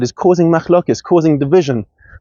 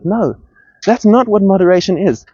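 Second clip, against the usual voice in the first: ordinary talk, nothing marked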